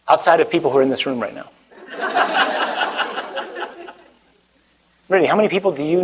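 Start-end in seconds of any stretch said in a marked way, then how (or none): 1.9-3.7 breathy high laugh; high giggle. Real, not acted. Laughing along with the young audience. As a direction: let a high airy laugh slip out. Crowd reaction, speaker laughs.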